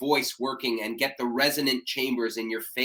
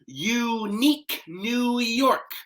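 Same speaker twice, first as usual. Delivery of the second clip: "Unique New York" slow and much higher, stretched "York"; exaggerated, slow; 9 semitones higher, much slower than usual. Shown as a demonstration. Coach modelling the twister again, slow and expressive. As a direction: draw out "Unique New York" with big mouth shapes. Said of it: "Exaggerate the sounds and be louder and more expressive".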